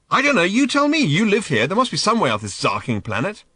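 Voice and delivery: loud, high exasperated outburst. A narrator's reading. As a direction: throw the frustration back at him. Ford frustrated with Arthur.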